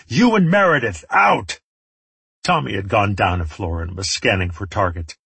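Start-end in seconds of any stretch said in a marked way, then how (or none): 0.1-1.6 high, loud urgent command; yelled order. A narrator's reading. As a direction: shout them out of danger. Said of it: "I yelled at him".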